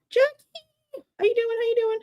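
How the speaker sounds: squeaky cartoon-high hello to Jackie. Real, not acted. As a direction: pitch it way up like a cartoon. Squeaky greeting.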